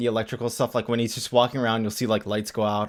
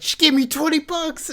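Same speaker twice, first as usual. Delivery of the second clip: put-on much higher delighted kid voice; excited kid. Fan voicing Billy telling Freddy about the money, high glee. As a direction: squeal over the cash. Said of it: "it's like".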